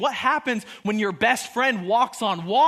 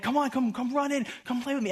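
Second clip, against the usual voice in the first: higher, light coaxing of a dog; 4 semitones higher, 3 dB quieter, faster than usual. Retold or imitated. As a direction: playfully invite a pet to join in. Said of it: "trying to get her, like".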